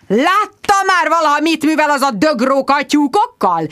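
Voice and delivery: high, indignant roar; outraged bellow. A narrator's reading. Sir Hugo roars at his visitor about foxes killing hens. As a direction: bellow it like an apoplectic squire. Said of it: "Bődült rám vérveres arccal Sir Hugo".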